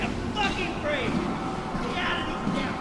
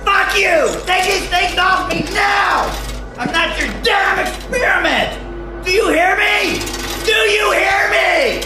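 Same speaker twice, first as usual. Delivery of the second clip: raging patient yelling to be released; enraged, desperate yelling; furious shouting; 6 semitones higher than usual. Acted. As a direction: scream at your captors to let you go. Restrained patient yells at staff.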